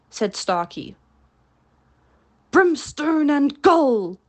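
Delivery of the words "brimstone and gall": loud, slow, furious oath; pirate captain raging at his men; thundering curse. A narrator's reading. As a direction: roar the curse at the crew. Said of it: "thundered Hook".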